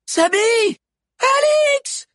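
high, loud calls; crying out names. A narrator's reading. Friends call to Sabi and Alix, high and loud. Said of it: "crièrent d'autres voix".

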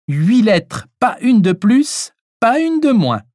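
high, emphatic ultimatum; demanding. A narrator's reading. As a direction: lay down terms, slowly and high-pitched. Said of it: "le somma Chantal". (ton de sommation)

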